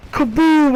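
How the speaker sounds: loud, high explosion noise; shouted kaboom. Retold or imitated. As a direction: blow the bridge up with your voice. Imitating the bridge explosion.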